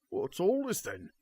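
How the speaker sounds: high, put-on guard voice; gruff guard voice. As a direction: bark it like a suspicious guard. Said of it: "and he says".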